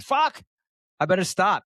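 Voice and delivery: sudden high exasperated curse; self-scolding outburst. Acted. Scroller catching himself wasting time, exasperated. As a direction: catch yourself and snap out of it.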